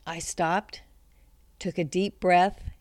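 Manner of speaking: ordinary talk, nothing marked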